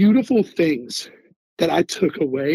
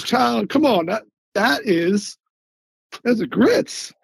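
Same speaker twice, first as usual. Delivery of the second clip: lightly mimicked Southern lady, pitched higher; Southern accent; 6 semitones higher, slower than usual. Southern food worker explains grits to a baffled recruit, in a light Southern accent. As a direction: imitate a Southern woman explaining, voice raised in pitch. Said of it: "she's very Southern accent".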